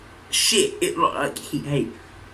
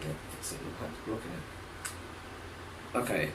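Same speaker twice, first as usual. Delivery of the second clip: much softer, almost whispered; under breath; 9 semitones lower, 20 dB quieter, faster than usual. Real, not acted. Fiddling with the hat, barely voiced. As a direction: mumble it to yourself. Muttering while fixing hat.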